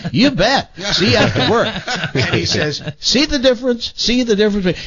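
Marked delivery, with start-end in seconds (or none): 0.1-1.7 mock eager woman. Woman in retold parody video. 3.0-4.8 mock smug announcer. Video announcer in retold parody.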